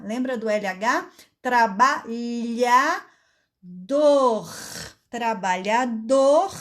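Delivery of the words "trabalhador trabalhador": "Trabalhador" drawn out slowly with a scraped final R; teacher modelling "Trabalhador" slowly; slow demo. Shown as a demonstration. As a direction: stretch "Trabalhador" out for children to follow. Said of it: "Ó o R no final, arranhando a garganta".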